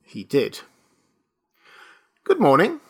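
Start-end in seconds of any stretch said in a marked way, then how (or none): 2.2-2.8 lightly voiced polite registrar, higher; a hint of a brisk official. A narrator's reading. Registrar greets the old-looking student, politely.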